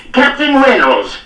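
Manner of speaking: high, loud, slow bellow of rage; bawling. A narrator's reading. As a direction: bellow his name across the yard. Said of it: "he bawled".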